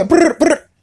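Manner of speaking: high buzzing brr of a ringing phone; lightly mimicked phone ring; phone ring imitation.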